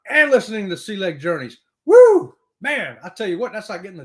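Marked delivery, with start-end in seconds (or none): 1.8-2.3 high, loud whoop; joyful high woo; excited woo. Real, not acted. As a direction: cheer it out big. Excited cheer on livestream.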